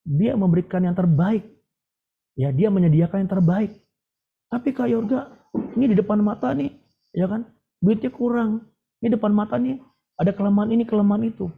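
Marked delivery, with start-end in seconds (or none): 4.5-11.5 high-pitched mock complaint; higher, fretful objection; doubting objector. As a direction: voice a worried listener making excuses. Voicing a listener's doubting objection.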